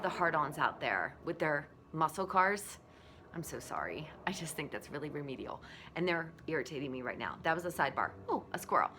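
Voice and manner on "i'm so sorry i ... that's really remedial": soft, unvoiced sorry; whispered aside. Real, not acted. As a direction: whisper the apology. Aside about muscle cars.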